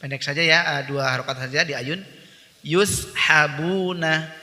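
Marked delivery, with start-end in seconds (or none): none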